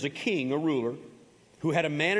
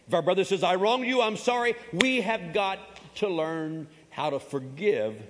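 higher, louder preacher's call; rising preaching; 5 semitones higher, 3 dB louder than usual. Acted. Pastor pressing the church to forgive, louder. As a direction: lift it to the congregation.

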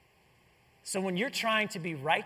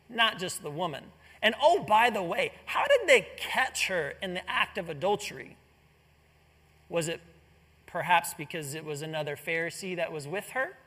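usual speaking voice

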